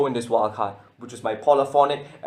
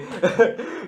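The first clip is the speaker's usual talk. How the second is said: short laugh; 8 semitones higher than usual. Real, not acted. Laughs at own excitement.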